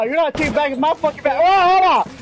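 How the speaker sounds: real high-pitched gamer yelling; loud, excited combat shouting; shouted trash talk. Real, not acted. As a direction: yell taunts at an enemy mid-fight. Trash-talking enemies during game fight.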